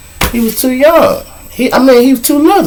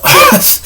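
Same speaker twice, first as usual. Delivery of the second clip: short laugh, way up high; laughing at the absurd ask; high incredulous laugh. Real, not acted. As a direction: burst out laughing in disbelief. Laughing at the request.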